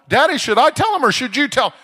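put-on high, loud child's skit voice; little girl. Seven-year-old on her daddy's shoulders asking him, little girl voice. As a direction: pipe up loudly like an eager kid. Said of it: "She'd sit up there and go".

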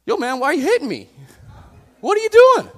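high, loud comic squawk of protest; indignant talking donkey. Acted. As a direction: yell it fast and high, outraged at being hit. Voices the donkey protesting being hit.

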